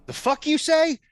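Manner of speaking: high, loud startled snap; gut reaction snap. Acted. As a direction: bark back on sheer reflex. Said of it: "gut check reaction".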